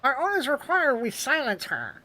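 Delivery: pinched, nasal soldier voice; nasally voice. Acted. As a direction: squeeze it through the nose. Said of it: "that's a really nasally voice".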